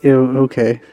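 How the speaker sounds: ordinary talk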